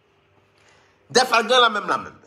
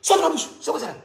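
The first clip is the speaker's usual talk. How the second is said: mock exorcism shout; 7 semitones higher, faster than usual. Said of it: "mon accent".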